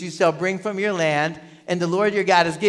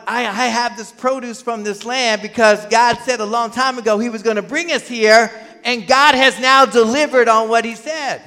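higher, building to a loud finish; emphatic declaration; 5 semitones higher, 6 dB louder than usual. Acted. Worshipper declaring that God kept his word, emphatic. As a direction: proclaim it with rising conviction.

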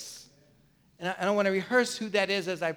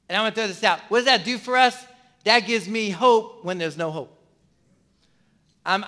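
usual speaking voice

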